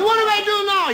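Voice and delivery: high, loud excited yelp; panicky drunk. Acted. Novice drunk with a fish on the line, high loud panic. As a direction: blurt it out in a panic.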